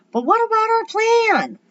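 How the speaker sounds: very high, loud protesting call; calling across hall. A narrator's reading. Howie calling to Eddie across the hall, loud protest. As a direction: cry out in dismay to someone far away. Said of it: "Howie called from across the hall".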